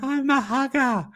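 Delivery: very high mock squeal; high-pitched silly mimicry. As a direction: squeal it out after a laughing fit. Mocking the hugger amid laughing fit.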